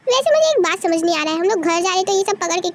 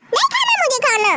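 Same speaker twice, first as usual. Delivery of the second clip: put-on stubborn child; 6 semitones higher than usual. Child refuses food.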